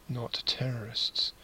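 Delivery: slow whispered aside. Real, not acted. Vlogger slipping viewers a punchline, whispered. Punchline about wildlife threat.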